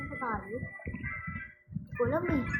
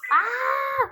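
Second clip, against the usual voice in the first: loud, high yelp of frustration; frustrated scream; 10 semitones higher, 12 dB louder than usual. Real, not acted. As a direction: let out an angry shriek. Annoyed in game.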